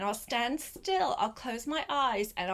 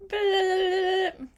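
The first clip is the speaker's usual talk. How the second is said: loud, high, silly blah; wombat blah; 10 semitones higher, 8 dB louder than usual. Pretend. Urging Gretel to copy Wombat, loud high blah. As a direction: throw your hands up and blurt a big blah. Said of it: "Put your hands up and go".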